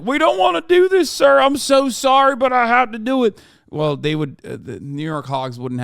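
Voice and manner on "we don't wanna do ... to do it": high, loud whiny sobbing cop; tearful cop. Pretend. Streamer imagines crying cops arresting Trump, high whiny wail. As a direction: wail an apology like a weeping officer. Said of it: "and they're like".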